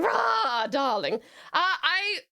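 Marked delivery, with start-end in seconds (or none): 0.0-1.1 "Rah, darling" with the r rolled at the back of the throat; French rolled r. Shown as a demonstration. Rolling her r the French way for a chat request. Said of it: "French style of rolling her R's".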